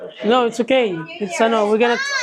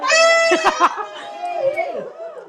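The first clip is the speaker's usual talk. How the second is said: high laugh. Real, not acted. Laugh after midnight.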